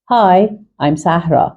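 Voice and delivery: Hi, I'm Sahra said very slowly; slow model line. Shown as a demonstration. Teacher modelling a greeting from the dialogue, very slow. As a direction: stretch the greeting out for learners. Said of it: "acts dialogue line slowly".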